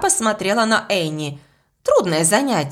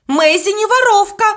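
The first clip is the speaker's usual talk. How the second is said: high, loud shout of defence; girl shouting to protect Maisie; 12 semitones higher, 6 dB louder than usual. A narrator's reading. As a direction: yell it to defend your friend. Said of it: "закричала Элис".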